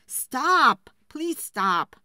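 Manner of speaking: loud, desperate cry to make it stop; begging shout. Acted. Woodcutter begging his scolding wife to stop, loud plea. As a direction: yell with hands over your ears.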